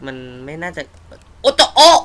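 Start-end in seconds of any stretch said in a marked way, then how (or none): 1.4-2.0 sudden high, loud yelp of fright; jolted by a jump scare; real startled cry. Real, not acted.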